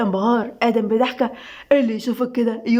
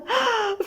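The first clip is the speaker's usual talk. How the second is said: high, sharp gasp of delight; gasp, dazzled; amazed gasp; 10 semitones higher, 5 dB quieter than usual. A narrator's reading. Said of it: "أسيل بانبهار". (شهقة انبهار)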